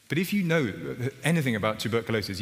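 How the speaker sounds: ordinary talk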